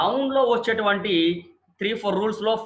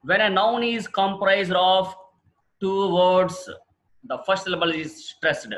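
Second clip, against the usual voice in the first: slow rule reading; 2 semitones higher, much slower than usual. Shown as a demonstration. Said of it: "reads rule slowly".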